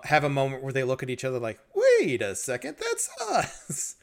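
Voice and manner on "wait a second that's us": high startled squeak; suddenly realizing, voice jumps up; surprised realization. Retold or imitated. Realizing they are the ones.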